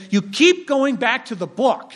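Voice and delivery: a hint of a complaining critic; mock critic voice. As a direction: critic complaining to the pastor, in a lightly mocking voice. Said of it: "If your critics say".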